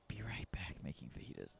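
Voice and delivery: soft whisper, barely voiced; whispered reread. Real, not acted. Streamer rereads the chat comment under his breath, whispering. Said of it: "That comment kills me".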